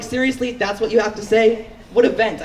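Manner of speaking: can't believe he said that; incredulous. Retold or imitated. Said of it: "I was like".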